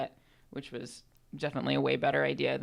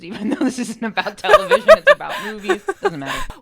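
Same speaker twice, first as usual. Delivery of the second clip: laughing through the words, higher and louder; 13 dB louder than usual. Real, not acted. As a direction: break up laughing at your own joke. Cracks up at own joke.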